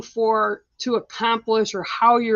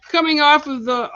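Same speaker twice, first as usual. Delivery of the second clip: laughing; 4 semitones higher, 6 dB louder than usual. Real, not acted. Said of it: "I have to laugh".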